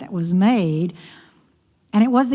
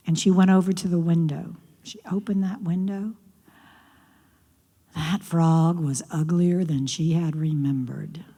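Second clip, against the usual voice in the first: soft unvoiced whisper; 4 semitones lower, 5 dB quieter than usual. A narrator's reading. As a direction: hush it like a secret. Narrator whispers suspense.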